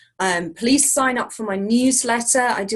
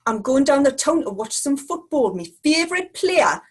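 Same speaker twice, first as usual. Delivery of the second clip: raised-pitch Geordie impression; Geordie accent; 4 semitones higher than usual. Shown as a demonstration. Guest repeating a given football line on request, Geordie accent. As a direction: do a playful, lilting Geordie. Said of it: "I'll try and say it in a Geordie accent".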